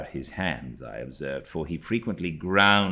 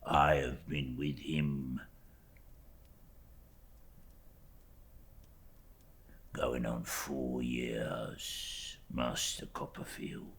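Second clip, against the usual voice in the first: slow, hushed servile voice; a slow, whispered, cringing clerk; fawning humble clerk; 3 semitones lower, 12 dB quieter, slower than usual. Acted. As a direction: lay the humility on thick. Uriah's voice in story reading.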